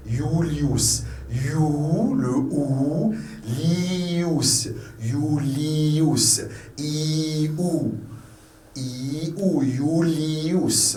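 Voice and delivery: "Julius" broken up and stretched, YOO-LII-OUS; every letter sounded. Shown as a demonstration. Teacher breaking the name down for learners, every letter sounded. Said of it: "Vous prononcez toutes les lettres".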